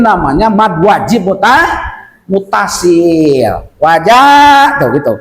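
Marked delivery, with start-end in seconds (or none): 3.8-4.8 Wajah with the second syllable held long; long vowel. Shown as a demonstration. Teacher shows the long mad, Wajah held.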